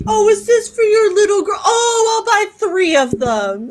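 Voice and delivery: high sugary voice imitating adults cooing over a child; cooing grown-up. Neighbors fussing over a little girl, high cooing.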